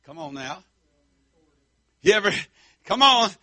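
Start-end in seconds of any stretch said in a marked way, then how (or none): none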